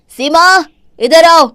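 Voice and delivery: loud, high furious call; angry shout. Acted. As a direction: yell for her to come now. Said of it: "क्यों चिल्ला रहे हो". (पत्नी को गुस्से में चिल्लाकर बुलाना)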